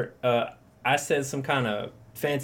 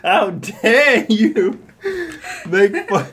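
slow, higher, loud outraged speech; hammed-up indignant activist, dragged out; mock the famous how dare you speech; Greta Thunberg; 8 semitones higher, 9 dB louder, much slower than usual. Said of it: "Fucking Greta Thunberg".

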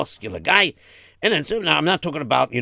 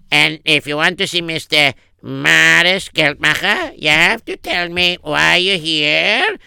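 higher voice, drawing out the conditions; officious secretary; 5 semitones higher, 4 dB louder than usual. Acted. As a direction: screen the visitor, slowing at the end. Acts the secretary screening visitor.

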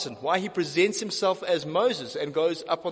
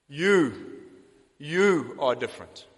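"You" hit hard and repeated before "you are different"; stress on YOU; 3 semitones lower, slower than usual. Shown as a demonstration. Preacher stressing "You" for the congregation. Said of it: "extra word in the Greek that makes this emphatic".

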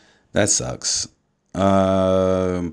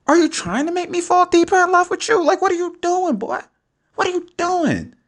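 very high-pitched, hammed-up protest; overwhelmed disbelief. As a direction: plead in mock outrage at being melted by love. Mock scolding son for melting him.

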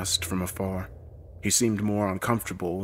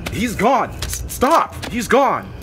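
high, loud, urgent shout; frantic, stumbling insistence; insistent shouting; 13 semitones higher, 7 dB louder, slower than usual. A narrator's reading. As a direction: shout to make them stop. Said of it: "Wayne insisted".

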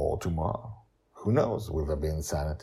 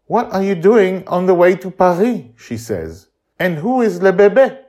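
high, loud with French touch; light French accent; lightly French Madeline; 13 semitones higher, 12 dB louder than usual. Acted. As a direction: exclaim with a hint of French.

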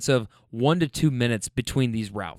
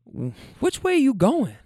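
lightly voiced granny, raised pitch; granny passenger; 9 semitones higher than usual. Granny asking her driver the way, lightly imitated. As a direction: imitate a questioning granny, higher. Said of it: "she's like".

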